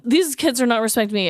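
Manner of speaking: ordinary talk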